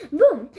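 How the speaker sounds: loud booming thunder. A narrator's reading. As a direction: boom it out like thunder. Thunder sound effect.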